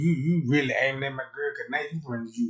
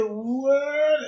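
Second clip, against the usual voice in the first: high, loud disbelieving what; shocked what; 13 semitones higher, 7 dB louder than usual. Retold or imitated. Storyteller yelping at the side chick in loud disbelief. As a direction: yelp it in outrage. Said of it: "I said".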